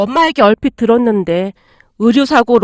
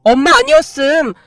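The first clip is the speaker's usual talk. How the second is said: indignant; 4 semitones higher than usual. Acted. Sister scolds her brother for betraying mom, high and indignant. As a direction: tear into him with outrage.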